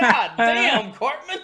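high, loud, appalled outburst; shocked exclamation. Acted. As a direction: blurt it out, half laughing, as a stunned onlooker. Onlookers' shocked reaction, acted out.

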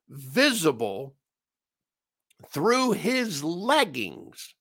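ordinary talk, nothing marked

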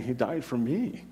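soft, slow, tearful quote; hushed and choked up, retold. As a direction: say it as if moved to tears. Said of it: "is with tears and saying".

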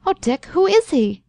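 high, anxious plea; pained premonition. A narrator's reading. Girl begs the man for the truth, anxiously. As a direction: ask with dread, pitch soaring. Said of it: "the sharp pain of premonition in her voice".

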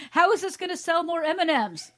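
loud, high, exasperated question; hammed-up cry of disbelief; mock outraged shout. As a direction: holler it like a baffled commenter. Reading an outraged commenter's question aloud.

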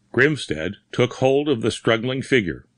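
ordinary delivery, nothing marked

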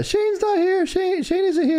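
high squeaky impression; Tony Khan voice. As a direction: squeak it out like a cartoon. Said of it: "he goes".

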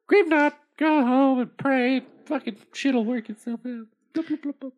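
goldfish voice. Acted. Goldfish answers again.

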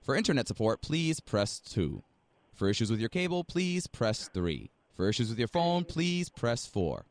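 slow recorded menu prompt; phone menu voice. Acted. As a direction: read it like an automated phone line.

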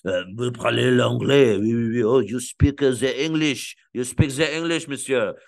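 hesitant, halting French-accented imitation. A local asking about English in a mock French accent. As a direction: play a French speaker fumbling into English. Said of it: "and they're like".

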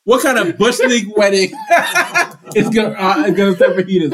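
high-pitched rant through laughter; laughing rant. Real, not acted. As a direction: rant while cracking up. Laughing outrage at fajita wedding.

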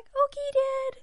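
put-on high, slow little boy voice; son's reply. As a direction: answer like a trusting little boy. Son answering his father in story.

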